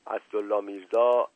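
ordinary delivery

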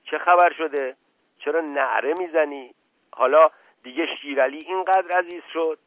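irritated shout in reply; shouting back. A narrator's reading. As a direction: fire back indignant questions. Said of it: "در مقابل فریاد کشید".

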